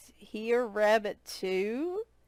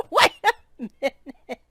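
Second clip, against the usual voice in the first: laughing; 5 semitones higher, 7 dB louder than usual. Real, not acted. Repeats it while laughing.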